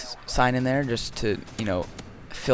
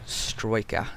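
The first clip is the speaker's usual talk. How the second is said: British accent; 5 dB quieter than usual. Shown as a demonstration. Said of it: "I could say that word all day".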